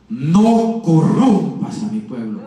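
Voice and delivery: low, loud, very slow booming command; thunderous voice of God. Retold or imitated. Preacher retelling God's warning to him, thunderous voice. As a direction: boom it out slowly like thunder. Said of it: "con una voz de estruendo".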